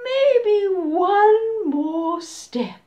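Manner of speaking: loud, slow coaxing; slow sly crocodile. Acted. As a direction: drag it out to lure him. Crocodile luring.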